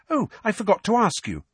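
high, lilting, casual brightness; bright, high-pitched chirp; brightly. A narrator's reading. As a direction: pipe up brightly as if just remembering. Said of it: "said Hermione brightly".

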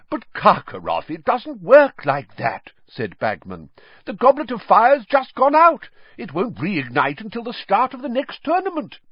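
flustered protest. A narrator's reading. Bagman hastily explaining to Karkaroff, flustered. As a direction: hurry to explain.

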